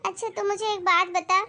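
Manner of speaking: normal talking voice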